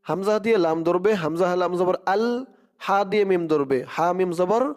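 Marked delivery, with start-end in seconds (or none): none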